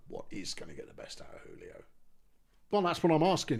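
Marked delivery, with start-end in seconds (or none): 0.1-1.8 breathy whisper to himself. Real, not acted. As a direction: mutter it under breath. Repeats it whispered.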